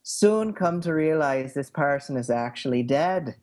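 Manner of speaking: put-on lilting Irish accent. Shown as a demonstration. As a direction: pose the question in an Irish accent. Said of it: "the Irish is probably the one I have the best handle on".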